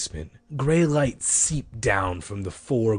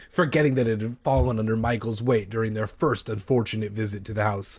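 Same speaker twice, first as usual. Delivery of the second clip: usual speaking voice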